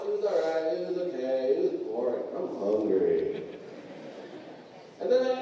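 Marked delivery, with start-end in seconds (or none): 0.1-3.4 put-on low, sinking, bored kid drone; bored kid response. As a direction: imitate a bored kid, pitch dropping lower with each answer. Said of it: "same response".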